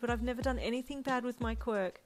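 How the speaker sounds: high, crying protest; tearful pleading. A narrator's reading. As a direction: plead through tears. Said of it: "you cried".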